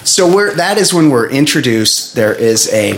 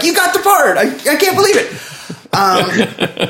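mock casting people; 10 semitones higher than usual. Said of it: "they're like".

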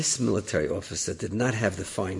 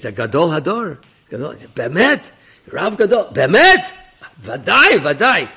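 rising, louder and higher with each Bemet and Vadai; excited praise; 6 semitones higher, 9 dB louder than usual. Retold or imitated. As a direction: build it up to a peak of enthusiasm. Rabbi extolling the great rav.

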